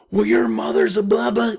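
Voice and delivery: high, loud scornful retort; hammed-up angry partner hitting back; mock reactive snap. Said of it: "if we're reactive about things".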